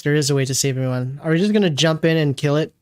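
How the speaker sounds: ordinary talk, nothing marked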